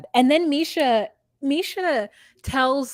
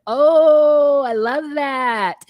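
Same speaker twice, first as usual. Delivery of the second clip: high, drawn-out mock gush, much louder on Oh; 5 semitones higher, 7 dB louder, much slower than usual. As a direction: swoon over it in a put-on voice. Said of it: "And I was like".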